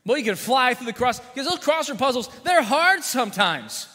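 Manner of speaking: ordinary delivery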